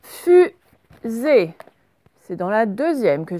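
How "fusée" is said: louder, with a gap between syllables. Shown as a demonstration. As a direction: split the word for pupils. Correction, découpe le mot en syllabes.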